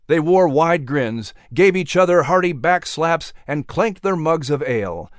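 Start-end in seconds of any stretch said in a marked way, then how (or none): none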